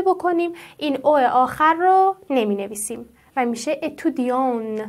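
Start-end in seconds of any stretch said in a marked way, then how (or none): 3.8-4.8 "اتودیون" ending on the nasal vowel, no t; silent final t. Shown as a demonstration. Teacher giving the masculine form, "اتودیون" with silent t. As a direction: leave the end of "اتودیون" without any t. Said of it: "ت تلفظ نمیشه".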